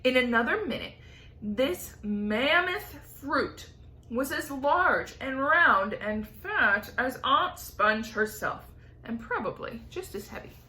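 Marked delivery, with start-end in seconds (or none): none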